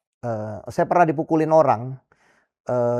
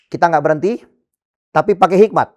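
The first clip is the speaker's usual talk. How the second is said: raised, louder voice, pushing the words out to the crowd; rallying call; 5 semitones higher, 7 dB louder than usual. Retold or imitated. Pastor urging his frightened church on, loud and firm. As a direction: rouse the congregation to keep going.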